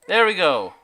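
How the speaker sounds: high, loud, triumphant cheer; excited cheer. Real, not acted. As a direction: celebrate loudly, stretching out the yay. Cheering render engine switching over.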